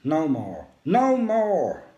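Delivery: loud, high desperate shout. A narrator's reading. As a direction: scream it out in anguish. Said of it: "I shout the words".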